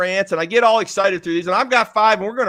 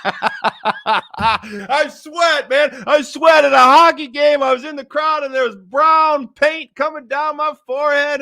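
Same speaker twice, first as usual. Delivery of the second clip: laughing shout; 5 semitones higher, slower than usual. Real, not acted. Laughing shout telling listeners about sweating paint.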